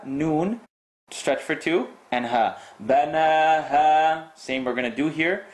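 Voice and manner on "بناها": بناها sung, the final ha held long; stretched ha. Shown as a demonstration. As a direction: hold the ha at the end of بناها. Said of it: "stretch the ha".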